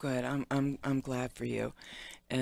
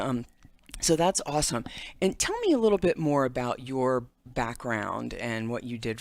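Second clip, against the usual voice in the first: normal talking voice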